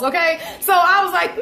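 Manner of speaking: ordinary talk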